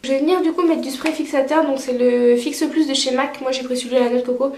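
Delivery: normal talking voice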